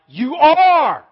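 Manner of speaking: thundering "You are"; emphatic declaration. Acted. Insisting on identity, climax.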